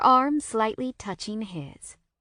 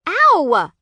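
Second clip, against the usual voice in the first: loud high whine, mouth full; 9 semitones higher, 11 dB louder than usual. A narrator's reading. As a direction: yelp through a mouthful of bread. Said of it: "I whine through a mouthful of bread".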